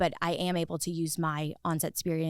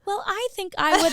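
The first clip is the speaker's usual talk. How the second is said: mocking actor; 14 semitones higher, 6 dB louder than usual. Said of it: "the actor or the talent is like".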